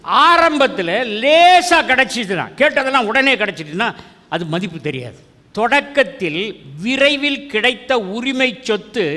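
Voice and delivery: full-force preaching. Acted. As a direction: preach it out to the congregation. Explains verse forcefully.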